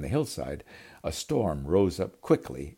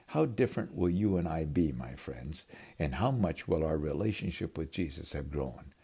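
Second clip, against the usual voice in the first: ordinary talk, nothing marked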